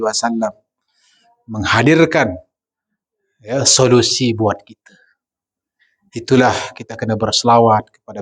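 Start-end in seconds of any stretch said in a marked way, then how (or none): none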